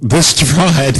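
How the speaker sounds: scoffing at the word "Distraught"; mocking repeat. Real, not acted. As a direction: sneer at how mild "Distraught" is. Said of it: "That just puts it so, that's mild".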